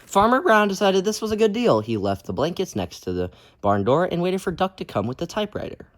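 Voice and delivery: lively, loud storybook reading; reading to children. A narrator's reading. Teacher reading a picture book aloud to kids, lively. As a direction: read it out big for the kids.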